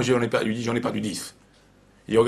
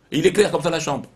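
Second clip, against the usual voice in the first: normal talking voice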